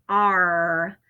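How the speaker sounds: growled pirate arr; pirate sound. Shown as a demonstration. Teacher reminding first graders of the pirate arr. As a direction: say it like a pirate would. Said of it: "like a pirate".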